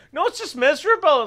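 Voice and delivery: mock exasperation. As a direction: imitate an exasperated local complaining. Retelling complaint about rainy country.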